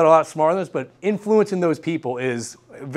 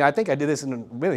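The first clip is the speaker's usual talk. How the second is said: ordinary talk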